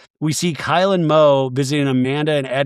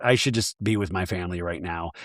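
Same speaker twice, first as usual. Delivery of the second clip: much lower and softer, withdrawn; quiet and low; 8 semitones lower, 7 dB quieter than usual. Retold or imitated. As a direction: murmur it, worn out with grief. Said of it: "Amanda's just like".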